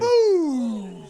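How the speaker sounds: loud, high "Boom!" like a ringside call; mock punch shout. Friend calling the kangaroo punch, loud shout. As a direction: bellow it as the punch lands.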